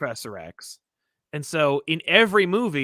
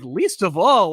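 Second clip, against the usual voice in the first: louder, incredulous repeat; taken-aback echo; 3 semitones higher, 6 dB louder, slower than usual. Acted. Viewer reacting to Professor X's snub, louder and incredulous. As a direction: throw it back in mock disbelief. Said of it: "And I'm like".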